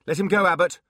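loud commanding shout. Acted. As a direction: order him to let go. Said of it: "she shouted".

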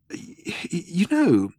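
hesitant start with a little cough; slight cough. A narrator's reading. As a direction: stammer into it after clearing the throat. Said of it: "said Arthur with a slight cough".

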